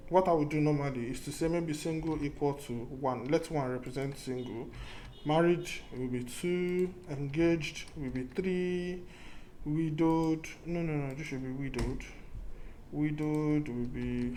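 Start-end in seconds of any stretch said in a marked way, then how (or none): none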